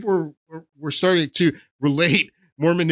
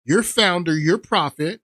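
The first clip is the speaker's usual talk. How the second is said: usual speaking voice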